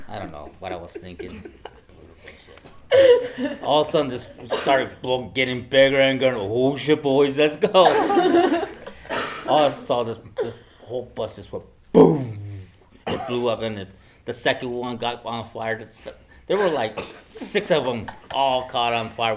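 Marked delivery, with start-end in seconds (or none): 1.6-3.6 big high whoop of laughter; loud laugh burst. Real, not acted. Old man laughing at his prank memory, loud laugh burst. 3.6-6.3 laughing excitedly. Real, not acted. Retelling bus explosion, laughing. 6.4-7.7 mock panicked yell. Boys fleeing burning bus. 7.7-19.3 laughing excitedly. Real, not acted. Retelling bus explosion, laughing.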